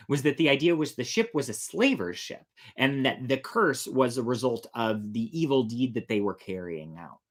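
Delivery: ordinary delivery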